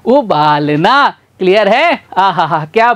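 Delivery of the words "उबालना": उबालना drawn out syllable by syllable; slow spelled-out word. Shown as a demonstration. Teacher slowly sounds out the finished word उबालना. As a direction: stretch उबालना slowly for the class. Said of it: "क्या बन गया?".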